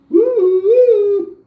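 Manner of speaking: high, loud falsetto police siren wail; mock a cop siren coming; siren imitation. Siren after calling the cops.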